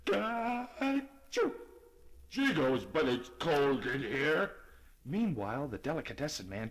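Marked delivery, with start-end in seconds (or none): 0.1-1.5 big sneeze. Acted. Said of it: "as he sneezed". 2.3-4.6 stuffy cold voice. Acted. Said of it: "as he sneezed".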